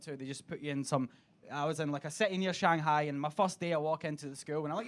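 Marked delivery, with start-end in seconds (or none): none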